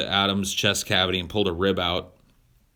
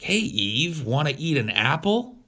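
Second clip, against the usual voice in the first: higher, coaxing serpent; sly snake voice; 7 semitones higher than usual. Retold or imitated. Talking snake offering Eve an apple, high and coaxing. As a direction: dangle the offer like bait.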